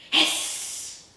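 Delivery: unvoiced angry hiss; angry snake hiss. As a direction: hiss back angrily. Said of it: "the snake replied angrily".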